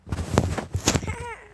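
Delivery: breathy villain cackle; evil laugh. Acted. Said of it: "I'm so evil".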